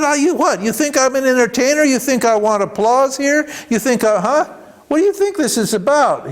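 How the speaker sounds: high-pitched indignant scolding; indignant rapid-fire questions, pitched well up; imitating furious guru. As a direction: act out an outraged teacher berating the crowd. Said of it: "he just got furious".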